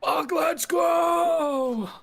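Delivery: loud, high, drawn-out victory yell; triumphant shout. Real, not acted. Celebrating winning the vote.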